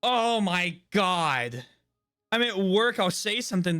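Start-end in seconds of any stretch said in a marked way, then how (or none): none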